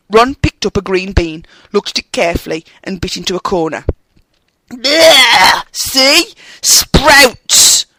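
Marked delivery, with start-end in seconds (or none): 4.7-7.8 loud, high yuck at a bad taste; disgusted spitting. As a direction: spit out the horrid flavour. Ron bites a sprouts bean.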